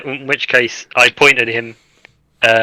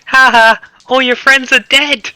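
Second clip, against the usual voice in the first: put-on high, slow creepy robot child; Fergan's robot voice; 12 semitones higher, 4 dB louder, slower than usual. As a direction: taunt like a creepy metal child. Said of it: "I forgot to do it in Fergan's voice".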